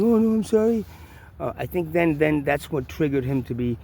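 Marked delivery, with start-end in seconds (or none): 0.0-0.8 higher, pleading little "sorry"; mock-timid sorry; meek apology. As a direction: play it small and apologetic. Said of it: "instead of being".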